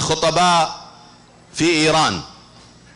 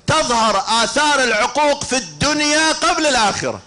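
preaching at full force; 9 semitones higher than usual. Acted. Preacher stirring the mourners, full voice. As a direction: preach it out to the congregation.

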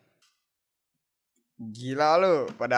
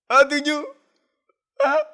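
excited yelp; 14 semitones higher, 9 dB louder than usual. Real, not acted. Reacting to the card drawn.

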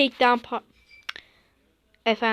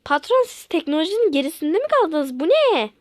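higher and louder, appalled; outraged disbelief; 6 semitones higher, 6 dB louder than usual. Acted. Employee shocked at the boss's handwriting order, higher and louder. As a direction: squeal at the old-fashioned order.

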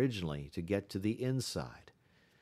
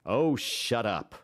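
slow, louder irritable snap; irritable outcry; 3 semitones higher, 5 dB louder, slower than usual. A narrator's reading. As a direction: cut him off, annoyed from bed. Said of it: "Scott cried out through the darkness".

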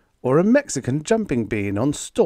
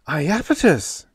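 gasped; 7 semitones higher, 4 dB louder than usual. A narrator's reading. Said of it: "gasped Diane".

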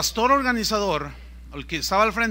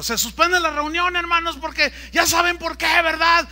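high, loud rally cry from the stage; rousing announcer shout; 9 semitones higher, 6 dB louder than usual. Retold or imitated. Organizer telling a packed stadium the meeting is off, rousing shout. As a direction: whip the crowd up like a hype man.

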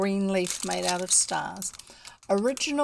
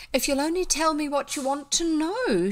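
high, lilting character voice; girl's coaxing; 9 semitones higher, 4 dB louder than usual. A narrator's reading. Elfrida asks her questioners to explain, high lilting plea. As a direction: coax them in a raised, girlish voice.

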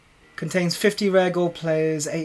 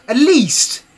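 loud exasperated plea; 4 semitones higher, 7 dB louder than usual. Real, not acted. Pleading with EA.

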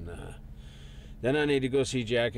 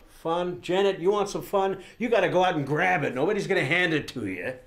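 loud, raised-pitch argument; heated lecture; 8 semitones higher, 5 dB louder than usual. Acted. Husband arguing with wife about fun, heated. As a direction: press the point hard at your wife.

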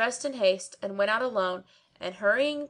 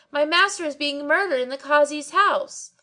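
high, loud alarmed yell; 7 semitones higher, 4 dB louder than usual. A narrator's reading. Barber yelling to the people, high and loud. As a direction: yell it to the crowd. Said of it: "he went on yelling".

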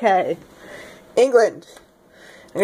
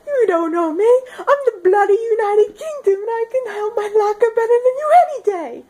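high, ranting mock British voice; hammed-up British character tirade; bad British accent; 12 semitones higher than usual. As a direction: boast indignantly in a squeaky English accent. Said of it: "I can't do the accent".

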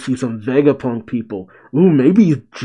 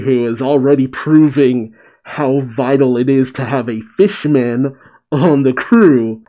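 normal talking voice

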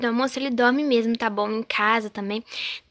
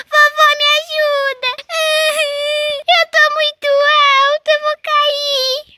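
put-on high little boy voice, climbing then panicking; boy calls mom and grandma from the tree; scared child; 8 dB louder than usual. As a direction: kid stuck up a tree crying for help.

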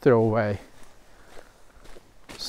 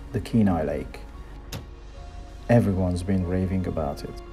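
voiceover narration; 5 semitones lower than usual. A narrator's reading. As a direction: lower calm travel voiceover.

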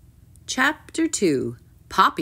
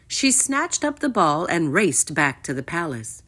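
usual speaking voice

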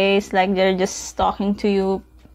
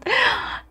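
sharp gasp; 4 dB quieter than usual. Real, not acted. Surprised by the last part.

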